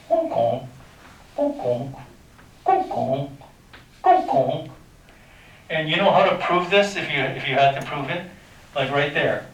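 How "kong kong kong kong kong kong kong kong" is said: repeated kong kong at the speaker's usual pitch, like a wave bouncing; steady kong kong echo sounds; sound-wave bounce noise. Shown as a demonstration. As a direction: tap out each bounce with a kong. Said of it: "It goes like this".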